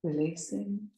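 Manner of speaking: soft, slow, drawn-out breath counts; guided breathing meditation. Acted. Yoga teacher counting listeners' breaths in and out, hushed meditative guiding. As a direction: pace the breath gently, holding each count long and level.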